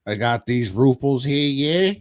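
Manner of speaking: hammed-up "oi mate" accent with rising tags; mock German guy, Cockney-style accent. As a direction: pitch the deal in a broad comic Cockney-style accent. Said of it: "the German guy was like".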